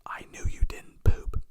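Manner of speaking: whispered comfort. Pretend. Grown self comforting his little boy self, in a whisper. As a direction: whisper it like a secret to a scared kid.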